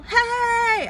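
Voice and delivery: high full yell; big battle yell; loud yell. Acted. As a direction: yell out like the army at the walls. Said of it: "I'm sorry, I yelled so loud earlier".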